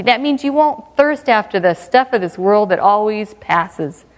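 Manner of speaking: usual speaking voice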